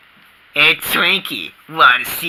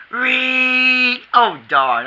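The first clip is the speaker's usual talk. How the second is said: ordinary talk, nothing marked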